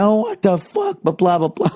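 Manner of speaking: exaggerated high-pitched protest; flipping out. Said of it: "just flipped out".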